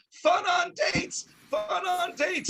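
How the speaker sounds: high, slow sing-out; mock catchphrase. Comic calls out a card gag, high and drawn out. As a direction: draw it out high like a jingle.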